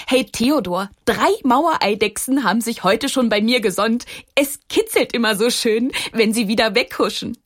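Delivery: a small stone happily boasting to its friend; Maxchen the little stone. A narrator's reading. Little stone Maxchen tells Theodor about lizards, cheerful.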